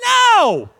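high, loud, drawn-out denial; emphatic shout. Acted. Preacher rejecting his own joke to the church, emphatic shout. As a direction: shout it down, pitch leaping high.

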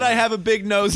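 high-pitched mock quote; mock quoting. Replaying a friend's remark to tease, high mocking voice.

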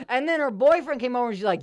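ordinary talk, nothing marked

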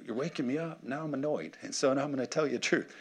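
quiet, groggy grumble of someone just woken; annoyed. Acted. Jesus to disciples who woke him, annoyed. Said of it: "where Jesus is, like, annoyed".

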